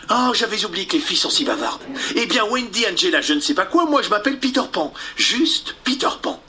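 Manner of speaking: voix de gamin, plus aiguë, rapide; garçon fanfaron. Acted. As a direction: se présenter fièrement. Peter se présente. (Peter fanfaron)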